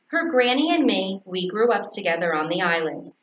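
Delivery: ordinary delivery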